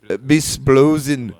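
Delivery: usual speaking voice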